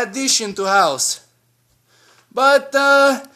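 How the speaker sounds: usual speaking voice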